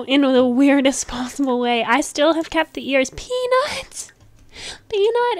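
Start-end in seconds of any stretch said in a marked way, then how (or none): 3.1-5.4 high delighted calls of the name; a happy high call, Peanut!; excited naming. Real, not acted. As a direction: sing out the name you just picked. Calling the dog's new name.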